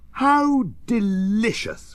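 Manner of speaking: high, drawn-out exclamation; delighted cry. A narrator's reading. Father hearing of dates for dessert, delighted cry. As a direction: cry out slowly with relish. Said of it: "cries Mister Banks".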